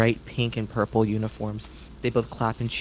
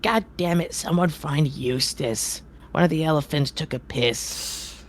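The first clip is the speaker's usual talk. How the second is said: grumpy ringmaster; 5 semitones higher, slower than usual. Acted. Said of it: "you hear him say".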